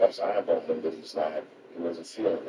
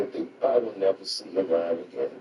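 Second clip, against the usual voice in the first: ordinary talk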